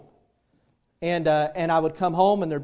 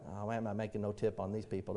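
low, much softer aside; grumbling waiter; 9 semitones lower, 16 dB quieter than usual. Retold or imitated. Waiter grumbles about getting no tip, low soft imitation. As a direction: imitate a waiter grumbling under his breath. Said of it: "waiter is like".

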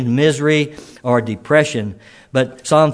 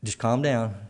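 softer, gentle reassurance; calm, soothing Lord; 4 semitones lower, 8 dB quieter than usual. Pretend. Preacher voicing the Lord calming a troubled listener, softly reassuring. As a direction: soothe the anxious soul quietly. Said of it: "the Lord's just saying".

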